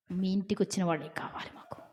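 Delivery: hushed threatening whisper; menacing whisper. Retold or imitated. Mob whispering to Lot that they want the guests.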